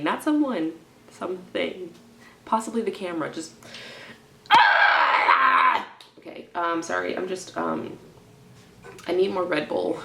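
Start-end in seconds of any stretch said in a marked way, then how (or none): 4.5-5.9 short loud yell of frustration; sharp loud cry, a bit higher; frustrated shout. Real, not acted. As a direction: let out a loud burst of pent-up stress. Frustrated, wants to hit something.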